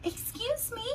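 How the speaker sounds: a small high cough of a word; coughed excuse me. Acted. Cricket interrupting Gerald in the clearing, small high cough. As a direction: politely clear your throat as the little cricket. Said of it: "coughed a cricket".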